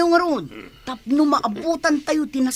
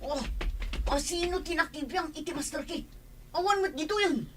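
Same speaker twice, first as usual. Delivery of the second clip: hushed worry; 3 semitones higher, 10 dB quieter, faster than usual. Acted. Noticing the car is missing.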